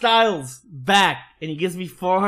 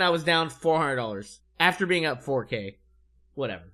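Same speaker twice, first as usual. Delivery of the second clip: ordinary talk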